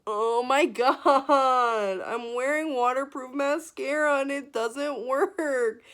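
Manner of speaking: overwhelmed and wailing about it; loud emotional outburst. Real, not acted. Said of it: "I'm literally tearing up".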